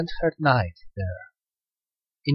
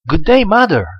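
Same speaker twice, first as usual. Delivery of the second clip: bright, much higher and louder hello; joyful greeting; 7 semitones higher, 7 dB louder than usual. A narrator's reading. Daughter greeting her mother on returning: excited. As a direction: burst out glad to see her mother.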